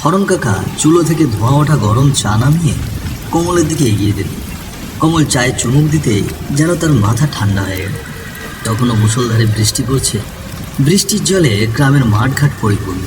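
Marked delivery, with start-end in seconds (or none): none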